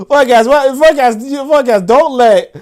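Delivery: high, loud, amused pleading with repeated false starts; laughing protest. Real, not acted. Host teasing a commenter about Mister Untouchable, laughing protest. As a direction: plead loudly through rising laughter.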